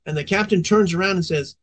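normal talking voice

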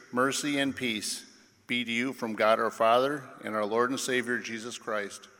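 ordinary talk, nothing marked

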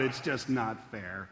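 soft, slow, deflated complaint; a gentle imitation of someone whining; mock lament. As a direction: sigh it out like a tired student. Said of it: "you might cry out".

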